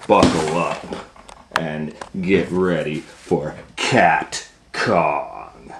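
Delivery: ordinary delivery, nothing marked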